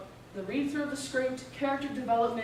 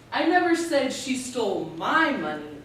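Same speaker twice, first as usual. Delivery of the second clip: "my" punched and held; stress on my; 4 dB louder than usual. Shown as a demonstration. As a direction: hit the word "my" hard. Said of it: "You can stress each word in that sentence".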